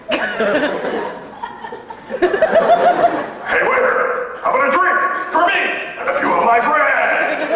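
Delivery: high, slow put-on Chinese accent to the waiter; hammed-up Chinese accent, stretching the last words; mock Chinese accent. A wannabe calls the waiter in a mock Chinese accent. Ordering as fake Chinese guy.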